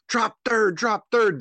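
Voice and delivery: high, loud call across the field; yelling. Retold or imitated. Coach yelling to the catcher from the dugout. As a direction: shout the reminder to the runner. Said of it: "if we start yelling".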